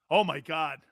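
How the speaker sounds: usual speaking voice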